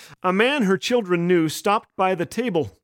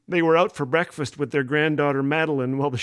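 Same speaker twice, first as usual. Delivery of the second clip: usual speaking voice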